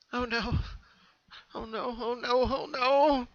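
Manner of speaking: high, panicked repeated gasps; pony horrified at the creature she killed, high and shaky; shaky breath. A narrator's reading. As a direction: gasp it out high in rising panic. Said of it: "Pinky said in a shaky breath".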